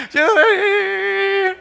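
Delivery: high, drawn-out imitated wail; mock bawling. Scolded child crying to his arriving father, mock bawling. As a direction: stretch it into a child's cry.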